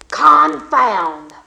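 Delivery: loud gruff exclamation; startled oath. Acted. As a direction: blurt it in shock. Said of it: "said Wicked John".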